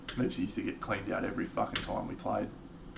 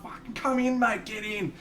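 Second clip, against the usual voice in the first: high-pitched cheering invitation; rowdy welcome; 12 semitones higher than usual. Retold or imitated. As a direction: call him in like a rowdy mate. Acted rowdy invitation to join.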